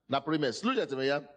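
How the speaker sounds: ordinary delivery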